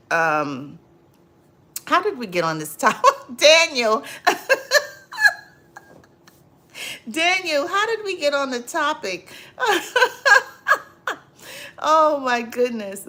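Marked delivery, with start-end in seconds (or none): 1.9-13.1 laughing through the question, pitched up and loud; can't stop giggling while asking. Real, not acted. Host laughing while asking viewer Daniel about the topic, laughing.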